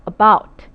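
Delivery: About said loud with the beat moved to the first syllable; wrong stress demo. Shown as a demonstration. Teacher showing wrong stress on About. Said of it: "일 음절의 강세가 왔음".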